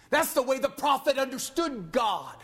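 high, impassioned pulpit delivery; fervent preaching. Real, not acted. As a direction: preach it with rising fervor. Preaching rises in force.

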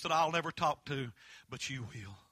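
ordinary talk, nothing marked

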